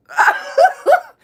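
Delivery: high, loud laughing shriek. Real, not acted. Streamer squealing with laughter at chat.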